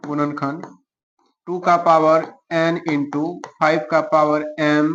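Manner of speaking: slow, piecemeal board dictation with long gaps. Real, not acted. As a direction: draw out each term while writing. Dictating the answer while writing. (लिखते हुए रुक-रुककर धीरे बोलना)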